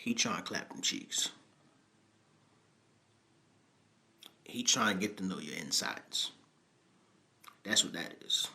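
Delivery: softly muttered innuendo; hushed aside. Real, not acted. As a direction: drop to a sly near-whisper for the crude joke. Cohost's hushed crude joke.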